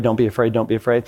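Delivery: ordinary delivery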